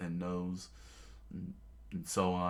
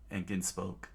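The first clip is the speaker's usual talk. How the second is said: usual speaking voice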